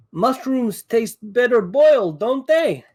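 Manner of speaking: high, drawn-out taunting yell; in-character yell. Acted. Player yells his bonus-action taunt at the Duergar, high-pitched yell. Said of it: "I'll yell".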